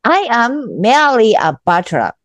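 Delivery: slow, careful repeat of the phrase. Shown as a demonstration. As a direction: repeat it slowly like a learner. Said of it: "repeating the taught phrase". (ゆっくり繰り返す)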